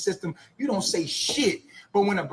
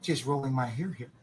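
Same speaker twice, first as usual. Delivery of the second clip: lower, much softer gentle patter; soft Mister Rogers voice; 7 semitones lower, 7 dB quieter than usual. Pretend. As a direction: murmur sweetly like a TV host. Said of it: "like Mister Rogers".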